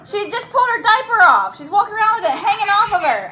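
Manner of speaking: high, loud and urgent; real exasperated alarm. Real, not acted. Urgently reports child's diaper mishap.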